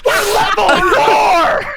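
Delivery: high, slow, stretched-out cry of alarm; drawn-out panicked yell; alarmed shout. Real, not acted. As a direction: wail it in disbelief at the odds. Player panics at strong enemy.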